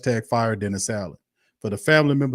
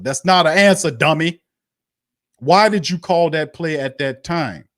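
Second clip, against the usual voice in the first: higher, louder, furious snapping; angry retort; 6 semitones higher, 7 dB louder than usual. Real, not acted. Fan yelling at the coach over his non-answer, angry and loud. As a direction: yell back at the coach.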